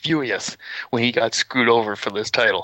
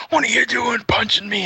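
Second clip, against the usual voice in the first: high, strained mock indignation; outraged protest; 11 semitones higher than usual. Friend wrongly punched rounds on Conrad, outraged protest. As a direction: act the wronged helper, outraged.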